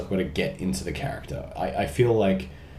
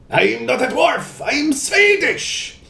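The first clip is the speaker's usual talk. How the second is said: much higher, very slow dwarf voice; Torbjorn voice; 5 dB louder, much slower than usual. Retold or imitated. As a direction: insist you're Swedish, drawn out. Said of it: "Torbjorn is one of my favorites".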